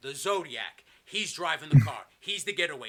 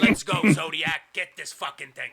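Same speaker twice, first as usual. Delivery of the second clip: loud start, acted boss command; a touch of gruff impatience; rallying order; 11 dB louder than usual. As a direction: urge the getaway driver on.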